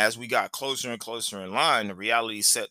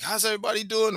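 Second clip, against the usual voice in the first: mock chirpy operator greeting pitched way up; hyped ride operator; 11 semitones higher, 4 dB louder, faster than usual. The ride operator greeting the nervous riders, high mock cheer. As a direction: greet the whole line like a peppy ride attendant. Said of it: "the guy's like".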